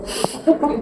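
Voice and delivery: whispered pain. Real, not acted. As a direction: breathe it out in a whisper.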